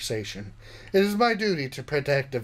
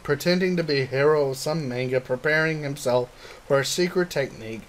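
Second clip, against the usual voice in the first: plain reading voice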